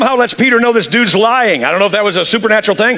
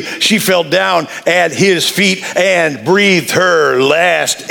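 ordinary delivery

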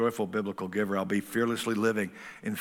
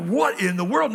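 surprised; 8 semitones higher, 6 dB louder than usual. Retold or imitated. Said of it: "You're like".

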